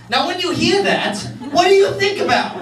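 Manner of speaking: usual speaking voice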